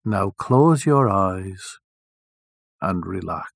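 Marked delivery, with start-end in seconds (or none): none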